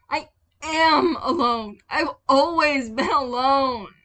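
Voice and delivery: high, loud anguished cry; loud high cry of a lonely boy; young Tim despairing. Acted. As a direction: shout out the loneliness. Tim shouts his despair.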